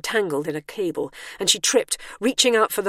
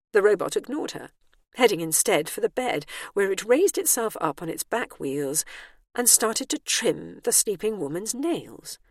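usual speaking voice

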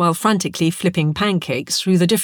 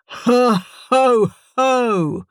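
raised, drawn-out ho-ho voice; Santa-style laugh; 6 semitones higher, much slower than usual. Acted. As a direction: try out a hearty Santa chuckle. Creature attempts a Santa laugh.

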